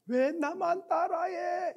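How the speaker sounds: high, drawn-out echo at normal volume. Retold or imitated. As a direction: bounce it back from the mountain. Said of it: "이렇게 들리는 거예요".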